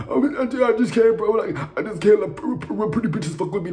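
hammed-up seal-squeal crying, mocking her. Mocking a castmate's crying as a high seal squeal. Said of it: "doing this motherfucking seal squeal".

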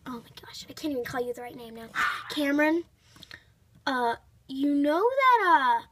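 ordinary delivery

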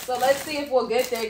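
ordinary talk

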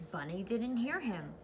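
ordinary delivery

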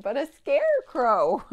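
loud, high playful reveal; spring the surprise on the kids; mock surprised announcement.

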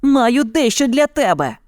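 put-on high, daring child voice; boy's acted shout. A narrator's reading. Boy threatens tiger with torch, high mild shout. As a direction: call the taunt out high. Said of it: "крикнув Мауглі".

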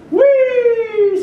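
a high, loud squeal of delight; gleeful scream. A narrator's reading. Thrown ball screaming with joy at its flight. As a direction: squeal it like a ball flying through the air. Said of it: "screams its trajectory".

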